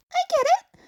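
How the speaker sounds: high, loud exasperated cry; groaning teen. Acted. Izuku groaning at Uraraka's accusations. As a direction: give in with a groan.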